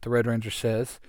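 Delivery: plain reading voice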